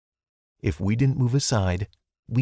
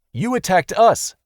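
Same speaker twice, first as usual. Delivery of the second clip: firm reminder; 9 semitones higher, 6 dB louder than usual. Acted. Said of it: "I reminded her".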